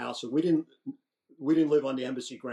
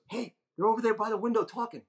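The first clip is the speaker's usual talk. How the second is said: higher, hammed-up voice of the unseen monitor; listening officer; 10 semitones higher than usual. Chinese officer telling the guard to check the microphone, mock order. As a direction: play the eavesdropper giving orders. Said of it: "Somebody goes".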